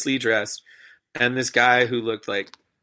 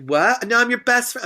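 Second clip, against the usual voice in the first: indignant heckler; 12 semitones higher, 4 dB louder than usual. Retold or imitated. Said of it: "she's like".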